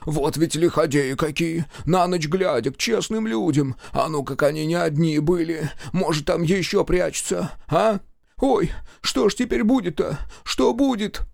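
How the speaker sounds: high, anxious wailing servant; lamenting old servant. A narrator's reading. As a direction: wail and fret in alarm. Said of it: "продолжил причитать".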